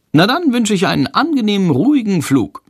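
high-pitched lady passenger; officious woman. A narrator's reading. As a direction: sign off high, as a busybody. Woman ends the exchange.

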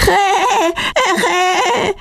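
high squawking jay call; imitated high-pitched bird cry; bird croak. A narrator's reading. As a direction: screech like a jay overhead. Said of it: "ein Krächzen".

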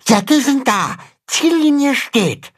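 very high small-creature voice; little animal reporting. A narrator's reading. Pünktchen reports the sack race is ready, little voice. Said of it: "berichtet Pünktchen".

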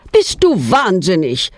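usual speaking voice

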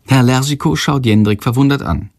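plain reading voice

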